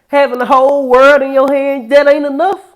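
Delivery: full-force pulpit cry; preaching shout. Real, not acted. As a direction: hammer it like a street preacher. Preacher climaxing against the elites.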